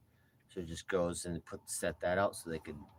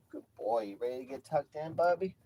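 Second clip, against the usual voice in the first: higher cooing at bedtime; pet talk; 6 semitones higher, 4 dB louder than usual. Real, not acted. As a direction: coo to the cat as you tuck him in. Tucking in old cat.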